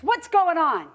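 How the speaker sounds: high, loud puzzled demand; demanding snake. Acted. Snake asks the recoiling bunny, loudly demanding. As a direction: call out for an explanation.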